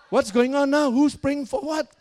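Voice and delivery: high, loud exasperated questioning; agitated retelling of a baffled reaction; indignant demand. Retold or imitated. Acted alarm at pastor's call.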